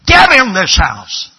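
sudden loud, drawn-out holler; comic explosion after a sweet set-up; mock-sweet parental yell. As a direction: bellow it slowly like a dad out the window. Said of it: "very sweetly you say".

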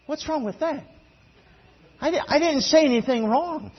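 imitated bewildered protest; baffled husband's thought. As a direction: play the clueless husband, voice lifted. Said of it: "you're sitting there thinking".

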